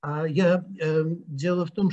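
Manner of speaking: ordinary talk, nothing marked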